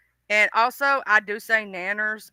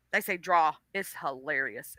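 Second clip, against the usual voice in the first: normal talking voice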